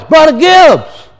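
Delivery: high, slow call across a crowd; high drawn-out calling voice. Retold or imitated. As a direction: hail a friend from a distance. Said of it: "he hears this voice".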